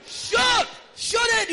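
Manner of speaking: high, loud command; preacher rallying the church, shouting high; fierce shout. Acted. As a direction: yell it like firing an arrow. Preacher urging to resist.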